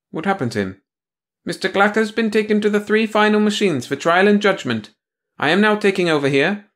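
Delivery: high prim bureaucrat voice; a prissy officious clerk; prim officious woman. Acted.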